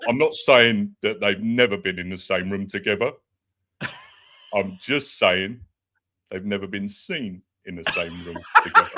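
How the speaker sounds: low-pitched impression of a co-host; Dan E. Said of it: "you got Dan E down as well".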